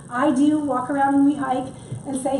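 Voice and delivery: ordinary delivery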